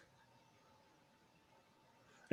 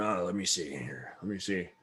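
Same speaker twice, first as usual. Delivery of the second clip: softer, lower murmur of someone searching; musing shopkeeper; 5 semitones lower, 8 dB quieter than usual. Acted. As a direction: hum it out while browsing, quietly. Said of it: "and you go".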